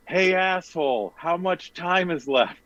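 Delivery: mock yell. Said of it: "somebody in Winnipeg yells".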